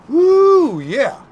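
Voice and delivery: high, loud whoop; excited whoop. Real, not acted. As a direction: let out a whoop of joy. Excited over the steak.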